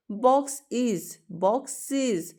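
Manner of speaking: "बॉक्स इज" split slowly, then "बॉक्सेस" with a clear iz; separated iz ending. Shown as a demonstration. Teacher showing students the iz sound in boxes. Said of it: "तो हमें क्या sound आई? इज sound आई".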